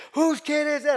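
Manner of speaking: high, loud yell of outrage; retold scream. Older lady confronting the bar about a child, imitated scream. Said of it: "I could hear her screaming".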